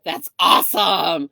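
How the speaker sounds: loud, slow, thrilled that's awesome; delighted. Retold or imitated. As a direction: blurt it out with big slow delight. Cheerful reply to layoff news.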